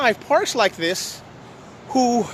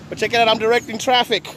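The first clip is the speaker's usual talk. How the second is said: ordinary delivery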